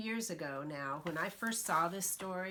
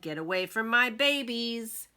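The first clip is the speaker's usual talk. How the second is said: louder mama duck warning; mother duck warning people away; protective warning; 5 semitones higher, 10 dB louder than usual. Acted. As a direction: warn them off her babies. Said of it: "she said".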